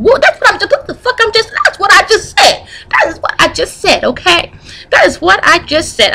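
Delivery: triumphant i-told-you-so; excited. Real, not acted.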